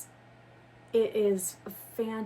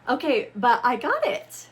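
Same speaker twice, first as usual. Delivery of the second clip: triumphant; 4 semitones higher, 10 dB louder than usual. Real, not acted. Finally frees the books.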